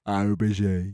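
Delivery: dropping Birmingham tone on "Aubergine"; Brummie accent. Acted. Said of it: "say aubergine".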